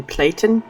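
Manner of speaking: "Platon" as PLAY-tun; trying a pronunciation. Shown as a demonstration. Unsure of a character's name, trying "Platon" as PLAY-tun. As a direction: say "Platon" with a long "ay", questioning. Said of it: "How do you pronounce that?".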